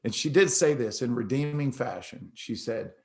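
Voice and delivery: usual speaking voice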